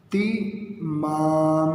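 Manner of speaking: اہتمام very slowly, "eh-ti-maam"; correct pronunciation. Shown as a demonstration. Teacher modelling the fifth word for pupils, correct pronunciation. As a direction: break اہتمام into syllables, stretching the last one. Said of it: "اس کا درست تلفظ".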